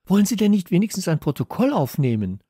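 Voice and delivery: hoch und flehend bitten. A narrator's reading. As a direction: Kalle fleht die Polizisten an. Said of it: "flehte Kalle". (flehend, höher und lauter)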